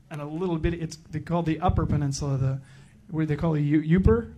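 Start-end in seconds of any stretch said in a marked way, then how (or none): none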